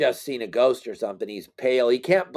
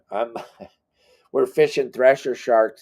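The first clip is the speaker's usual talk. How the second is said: usual speaking voice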